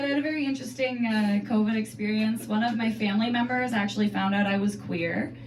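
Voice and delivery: ordinary talk, nothing marked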